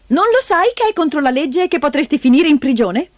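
high, indignant woman; high-pitched reproach; scolding aunt. A narrator's reading. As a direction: lecture him with a warning. Aunt threatens grandfather with law.

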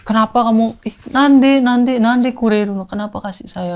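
suara cewek dibuat lebih tinggi, agak pelan di akhir; tiruan cewek terharu. Retold or imitated. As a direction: tirukan cewek yang terharu bertanya kenapa. (menirukan cewek Jepang yang terharu diberi gelang)

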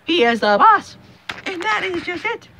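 high, loud gleeful hype; crowing over the win, voice way up high; hyped-up shouting. Real, not acted. Vlogger crowing to viewers about the win, high and loud. Excited rant opening about Trump.

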